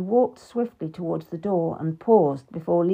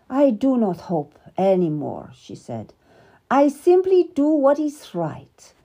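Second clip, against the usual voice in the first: lightly higher, a touch firmer at the close; resigned queen; 5 semitones higher, 4 dB louder than usual. Acted. Queen to her lady about the king, resigned and firm.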